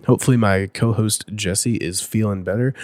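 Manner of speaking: ordinary talk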